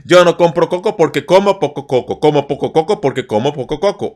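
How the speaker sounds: quick rattled syllables; fast tongue twister. Shown as a demonstration. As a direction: spit it out fast. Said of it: "Let's try one more time".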